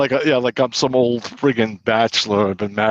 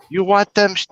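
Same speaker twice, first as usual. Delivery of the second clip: Korean lady's voice; 8 semitones higher than usual. Retold or imitated. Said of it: "the lady's going".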